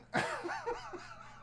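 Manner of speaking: a very high, squeaking giggle; can't hold it in; squeaky laugh. Real, not acted. Streamer giggling at the joke card.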